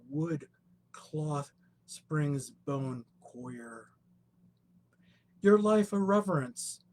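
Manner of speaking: lyrical, reverent poem recital; poetry reading. Acted. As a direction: read it like an elegy. Poet reads poem aloud.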